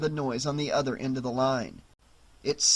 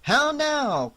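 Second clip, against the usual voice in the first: high, loud battle cry; shouted; 11 semitones higher, 5 dB louder than usual. Shown as a demonstration. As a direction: bellow it like a knight calling out. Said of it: "A cry also means a shout".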